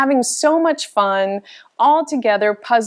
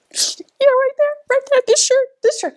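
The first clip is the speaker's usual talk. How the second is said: much higher, giddy and urgent; excited squeal. Real, not acted. Vlogger showing off her sponsor shirt, high excited squeal. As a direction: point at it, bursting with glee.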